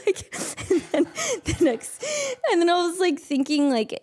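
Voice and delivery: high, breaking into laughter; laughing. Real, not acted. Telling her own paranoid story, laughing hard. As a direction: laugh through the words.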